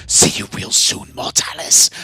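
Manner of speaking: hissed, breathy demon voice; demon whisper. Acted. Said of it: "The demon ... spoke through".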